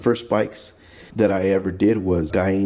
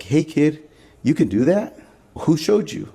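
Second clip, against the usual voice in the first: put-on raised, impressed voice of an old lowrider; amazed old-timer; 7 semitones higher than usual. Old hot rodders marvel at the kid's work, amazed. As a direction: sound floored that a kid can do this.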